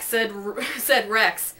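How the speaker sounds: usual speaking voice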